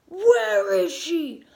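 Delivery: long, drawn-out high shout; furious demand. Retold or imitated. Mother pounding on the house for her daughter, furious demand. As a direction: yell it desperately, stretching each word. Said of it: "turning into the Batman and the Hulk".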